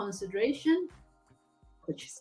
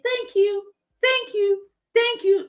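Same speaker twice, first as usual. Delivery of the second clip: mock child thanks; 11 semitones higher, 4 dB louder than usual. Mocking child expected to thank.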